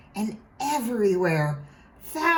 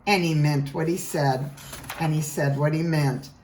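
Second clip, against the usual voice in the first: ordinary delivery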